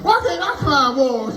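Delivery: slow dreamy wondering; slow wistful. Retold or imitated. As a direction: drag it out longingly. Slow wistful kid thought.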